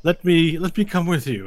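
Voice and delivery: loud, high-pitched call to a hero; eager tavern woman. Acted. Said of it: "a woman raises her head from one of the tables".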